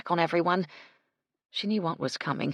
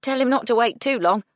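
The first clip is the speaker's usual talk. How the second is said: loud, higher bossy maid; 7 semitones higher, 8 dB louder than usual. A narrator's reading. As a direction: tell her off like an older sister. Gertie's bossy advice.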